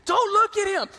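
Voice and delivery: mock desperate kid begging; a scared son hoping it isn't dad; pleading boy. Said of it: "I'm like".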